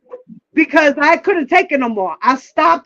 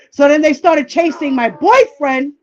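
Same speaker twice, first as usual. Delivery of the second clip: normal talking voice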